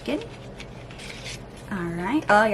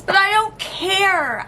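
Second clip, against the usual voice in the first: defiant; 10 semitones higher, 5 dB louder than usual. Real, not acted. Defiant about season.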